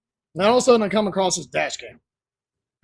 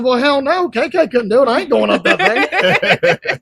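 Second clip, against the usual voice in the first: higher, incredulous retold refusal; emphatic refusal; 4 semitones higher, 4 dB louder than usual. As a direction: retell your flat-out no to a dare. Quoting own refusal to a dare.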